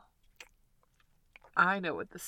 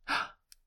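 sharp surprised gasp; little playful gasp; 4 dB louder than usual. Acted. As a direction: catch your breath in mock surprise. Caught in a slip.

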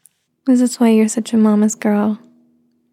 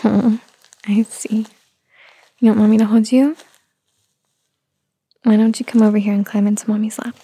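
put-on soothing a child. Soothing fussy baby.

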